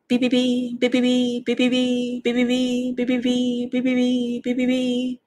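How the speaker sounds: high, monotone rapid peeping; goslings. A narrator's reading. Seven goslings answering roll call, high steady peeps. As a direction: peep like a string of baby geese. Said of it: "said the goslings".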